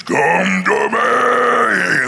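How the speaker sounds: very slow, shouted booming call; echoing giant call. Acted. Goliath bellows to David across the valley, very slow. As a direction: let it echo across the valley. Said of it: "the mutants doing this".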